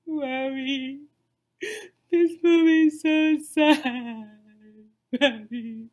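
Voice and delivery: loud, drawn-out imitated whine; mock child wailing. Mother imitates her ten-year-old upset by the movie, mock wail.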